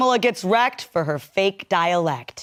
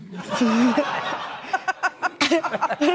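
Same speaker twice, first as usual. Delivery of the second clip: long amused laugh; real, drawn-out laughter; 4 semitones higher than usual. Real, not acted. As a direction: crack up at the joke. Laughs at comb joke.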